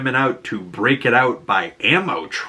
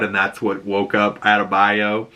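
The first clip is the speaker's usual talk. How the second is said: ordinary talk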